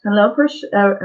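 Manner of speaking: normal talking voice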